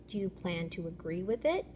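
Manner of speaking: usual speaking voice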